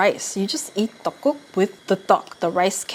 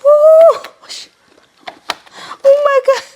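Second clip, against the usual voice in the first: horrified; 8 dB louder than usual. Real, not acted. Horrified at salty taste.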